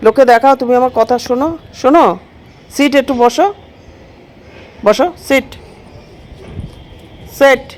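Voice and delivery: high coaxing voice telling a pet to sit; pet talk. Real, not acted.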